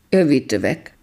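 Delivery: plain reading voice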